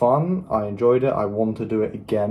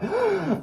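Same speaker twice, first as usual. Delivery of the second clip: sharp gasp. Retold or imitated. Retold shocked reaction.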